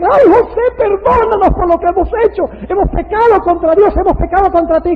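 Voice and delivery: loud, high-pitched mock wail; hammed-up plea of weeping brothers; imitated tearful pleading. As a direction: beg forgiveness in an acted tearful cry. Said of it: "empiezan a llorar y le dicen".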